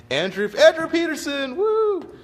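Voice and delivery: high, loud whooping hello; excited greeting. Real, not acted. Hailing an old friend who joined the stream, excited whoop. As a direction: cheer the name out, then whoop.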